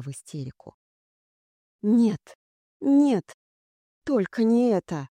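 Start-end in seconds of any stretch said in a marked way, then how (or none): 1.8-5.1 high, louder cries of desperate denial; panicked inner scream. A narrator's reading. Heroine unable to move in the dark, screaming in panic. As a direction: cry out in hysterical disbelief. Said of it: "беззвучно кричала я".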